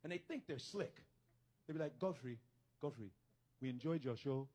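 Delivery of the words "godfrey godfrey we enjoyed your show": lightly imitated Nigerian accent, lower pitch. Nigerians greeting the comic after his show, Nigerian accent. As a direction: put on a low Nigerian accent. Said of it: "that's how Nigerians talk".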